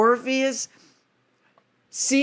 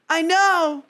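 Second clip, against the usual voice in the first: lightly voiced Orpheus; 8 semitones higher than usual. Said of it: "And he's like".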